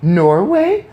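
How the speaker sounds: put-on the boy guessing in a burst; loud excited exclamation; excited cry. A narrator's reading. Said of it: "I cried".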